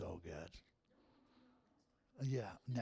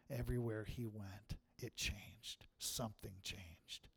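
softer, dropping low on the echo; hushed preacher repeat; 5 semitones lower, 9 dB quieter than usual. Acted. Pastor to congregation, hushed and reflective. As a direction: let it sink in quietly.

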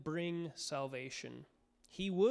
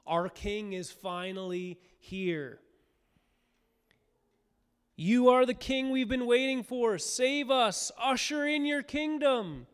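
higher, louder hopeful cry; crowd acclaim; 7 semitones higher, 5 dB louder than usual. Retold or imitated. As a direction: call out to the long-awaited king. Crowd hailing king, sermon.

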